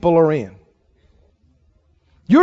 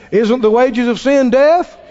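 ordinary talk

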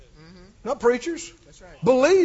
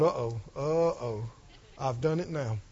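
mock scared; 8 semitones lower, 7 dB quieter than usual. Woman caught after touching Jesus, hushed mock fear. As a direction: sink into a quiet, acted dread. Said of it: "she gets scared".

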